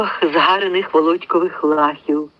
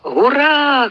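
war cry; 8 semitones higher than usual. A narrator's reading. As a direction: charge forward with a cry. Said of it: "крикнули угорщани". (бойовий клич)

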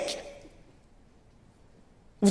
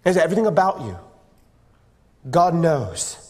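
solemn closing; 6 semitones lower than usual. Real, not acted. Preacher closes telling youth God knows them, solemn and low. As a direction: land each phrase gently with pauses between.

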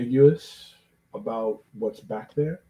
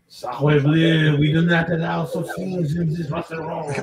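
loud, higher, hammed-up French accent; mock French accent; 5 semitones higher, 5 dB louder than usual. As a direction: play an outraged French restaurateur with a thick accent. Joking as outraged French restaurateur.

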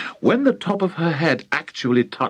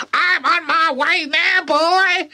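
very high, crowing call; slower than usual. Acted. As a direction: call down from above. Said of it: "she called down to George".

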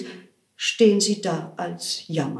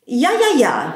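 high, loud exclaiming; cried out; 5 semitones higher, 7 dB louder than usual. Acted. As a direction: call out the yeses emphatically. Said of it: "rief Meister Böck".

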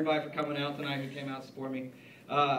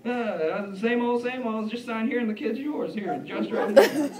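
higher-pitched chummy patter; imitated UPS guy, all friendly; overly friendly deliveryman; 6 semitones higher, slower than usual. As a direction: chat away like an old pal at the door. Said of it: "always so frickin' friendly".

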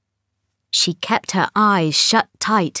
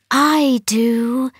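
hissed; 4 semitones higher than usual. A narrator's reading. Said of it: "hissed the east wind".

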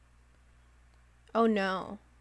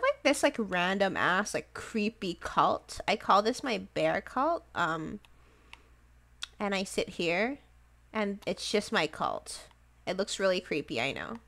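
ordinary delivery

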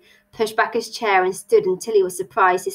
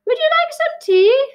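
very high, loud little voice; dormouse voice; 5 dB louder than usual. Acted. Dormouse offers the stranger tea, piping up high. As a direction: pipe up like a tiny creature.

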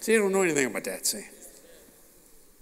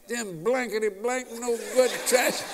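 mock cussing; 7 semitones higher than usual. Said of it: "You fill in the blanks".

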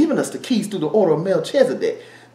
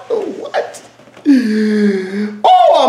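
high giddy laugh into what; 7 semitones higher than usual. Real, not acted. Preacher laughing at his own excitement, high giddy laugh. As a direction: break into a delighted laugh.

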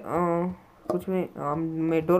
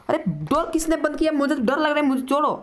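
terrified; 9 semitones higher than usual. Real, not acted. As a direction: cry out as if grabbed. Door slams shut in game.

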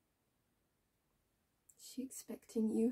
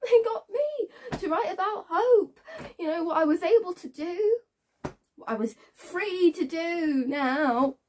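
high, loud, anguished sarcasm; bitter outburst; 8 semitones higher, 5 dB louder than usual. Acted. Woman recalling forced letters about hope, bitterly worked up. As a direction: push out the words with rising bitterness, stretching some.

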